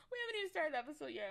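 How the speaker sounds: high, fast, laughing through words. Real, not acted. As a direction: laugh while you say it. Laughing at herself.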